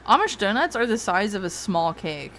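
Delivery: ordinary delivery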